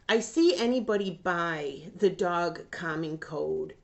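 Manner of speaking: ordinary delivery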